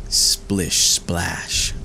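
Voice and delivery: ordinary delivery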